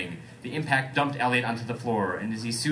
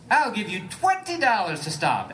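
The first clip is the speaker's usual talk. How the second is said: called out; 9 semitones higher, 3 dB louder than usual. Acted. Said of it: "Elliot called".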